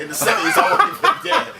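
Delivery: laughing. Real, not acted. Laughing at his own rant.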